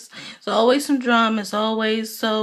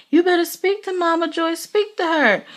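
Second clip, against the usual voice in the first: higher, louder scolding mimic; stern mom; 9 semitones higher, 3 dB louder than usual. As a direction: mimic mother ordering her son. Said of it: "And Phaedra just like".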